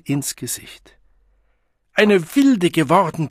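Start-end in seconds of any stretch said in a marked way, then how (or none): 1.9-3.3 raised, loud angry roar; furious bellowing. A narrator's reading. Mother raging at her child, bellowing. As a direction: bellow it bitterly. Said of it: "brüllte sie".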